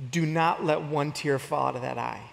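low, measured stern command; Coach English. Retold or imitated. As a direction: intense coach laying down the law, held back. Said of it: "he looks at me, and he goes".

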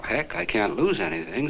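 normal talking voice

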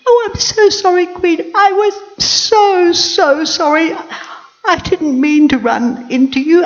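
high, loud squeaking mouse voice; squeaky mouse. Acted. Little mouse apologising to the queen, high squeaky voice. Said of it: "it squeaked at me".

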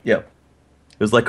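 usual speaking voice

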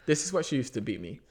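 ordinary delivery

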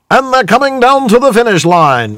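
put-on high, bright old newsreel announcer. Shown as a demonstration. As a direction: call it like a vintage newsreel. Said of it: "need a newsreel voice".

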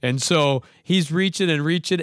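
ordinary delivery

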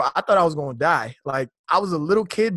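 ordinary talk, nothing marked

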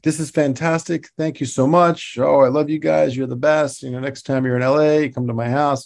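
retold effusive thanks; imitated gushing praise. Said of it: "loved the layout that we did".

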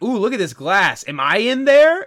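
high and loud with amazement; wonder-struck Derek. Retold or imitated. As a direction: gasp at your own reflection, childlike. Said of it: "and he's like".

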